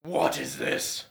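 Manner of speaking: a giant beast, puzzled and low; deep dragon voice. Acted. The dragon turns to face.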